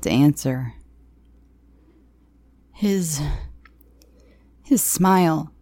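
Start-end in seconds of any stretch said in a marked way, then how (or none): 2.7-5.5 mild frightened whimper; understated, hesitant whimper. A narrator's reading. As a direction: falter on the answer, timid. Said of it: "I whimpered".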